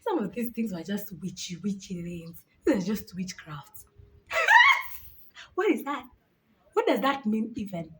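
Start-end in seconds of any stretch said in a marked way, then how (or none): 4.3-4.9 high squeal of laughter. Real, not acted. Laughing at witchy myth.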